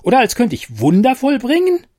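a tiny fairy taking offence; indignant fairy. Acted. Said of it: "gab die Fee erbost zurück".

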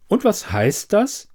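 high-pitched, slow bewildered question; confused. A narrator's reading. Quizmaster asking Elida what it means, confused. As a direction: ask it slowly, at a loss, pitch lifted. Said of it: "fragte er verwirrt".

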